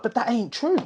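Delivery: high-pitched disbelieving protest; a young man protesting to police, high and incredulous. Retold or imitated. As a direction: squeak out the denial in disbelief. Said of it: "I was like".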